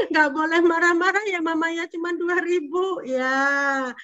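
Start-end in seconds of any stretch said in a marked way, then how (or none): none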